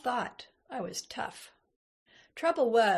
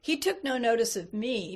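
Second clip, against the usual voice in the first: ordinary delivery, nothing marked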